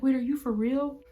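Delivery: high, loud, incredulous question; startled disbelief. Retold or imitated. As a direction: imitate a coworker suddenly alarmed. Coworker suddenly realizes it is serious.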